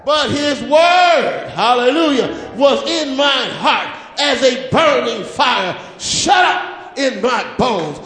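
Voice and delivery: preaching at full force. Real, not acted. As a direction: preach it out with the fire building.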